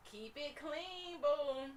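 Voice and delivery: high, slow sing-song "Keep it clean, boo"; cheerful high coaxing. Acted. Dentist voicing happy teeth cheerfully.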